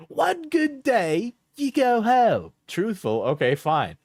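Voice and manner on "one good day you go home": very high, drawn-out mock Cockney; attempted Cockney. Streamer acting out a partner's insults, mock Cockney. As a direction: stretch it out in a hammed-up, high Cockney. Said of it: "It's Cockney, I think".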